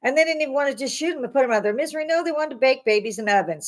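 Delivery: normal talking voice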